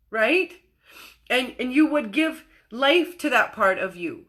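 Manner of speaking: normal talking voice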